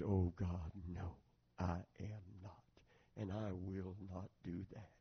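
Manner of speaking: very low, hushed reply to God; subdued, solemn retold prayer; humbled quiet refusal. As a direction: let each phrase drop softly, with pauses.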